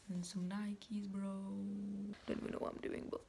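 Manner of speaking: whispered, barely voiced; whispering. Shown as a demonstration. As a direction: whisper it under your breath. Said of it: "I don't even know what I'm doing".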